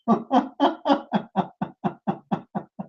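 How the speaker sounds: soft airy laugh, high and unvoiced; breathy mocking laugh. Real, not acted. Debater laughing at an opponent's claim, soft airy laugh.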